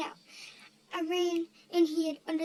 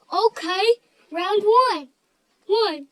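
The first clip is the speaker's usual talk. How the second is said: much louder, calling the round like a fight host; mock ring announcer; 2 semitones higher, 10 dB louder, much slower than usual. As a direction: belt it out like starting a match.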